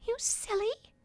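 very high, small fairy voice; whispering fairy. A narrator's reading. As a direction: say it tiny and close in his ear. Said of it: "She whispered in his ear".

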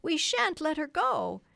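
high, loud defiant cry. A narrator's reading. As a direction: boys refusing to let her go. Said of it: "they cried".

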